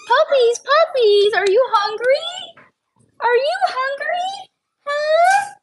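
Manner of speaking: squealing dog voice. Real, not acted. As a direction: coax the hungry puppies. Asking puppies in baby talk.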